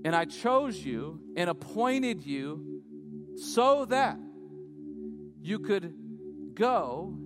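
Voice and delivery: slow, drawn-out preacher delivery with rising force; emphatic preaching. Acted. Preacher quoting Jesus to his disciples, slowly emphatic. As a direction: stretch the key words and leave weighty pauses.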